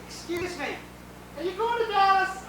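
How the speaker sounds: high voice rising out of a moan; high aroused strain. Acted. Bride asking passengers mid-romp, high and strained. Bride covers moaning with small talk.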